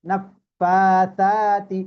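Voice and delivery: নাফফাতাতি with the alif drawn out very slowly; stretched vowel. Shown as a demonstration. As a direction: hold the long vowel in নাফফাতাতি. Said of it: "একালিফ টান".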